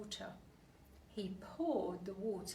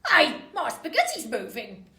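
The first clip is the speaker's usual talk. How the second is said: higher, loud alarmed cry; surprised cry; 6 semitones higher, 17 dB louder than usual. Acted. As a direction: cry out in shock at your plate. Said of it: "cried Mister Twit".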